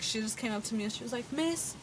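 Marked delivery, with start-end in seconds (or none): 1.3-1.7 put-on higher, soft kid calling the teacher; student impression. Teacher retelling a student's approach, higher soft kid voice. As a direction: imitate a pupil getting a teacher's attention. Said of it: "impression of my students".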